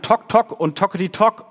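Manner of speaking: high, loud rhythmic pecking; knocking noise. A narrator's reading. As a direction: rap it out like a woodpecker. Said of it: "so klang es".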